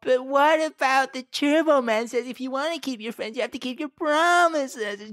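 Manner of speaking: hammed-up little boy pleading; whiny kid voice. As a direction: whine like a let-down boy. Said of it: "It's like".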